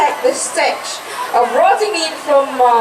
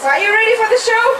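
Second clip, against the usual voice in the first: put-on overbright kids party host; 7 semitones higher, faster than usual. Shown as a demonstration. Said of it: "you don't sound ready".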